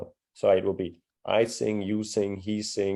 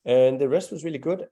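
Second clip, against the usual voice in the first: ordinary delivery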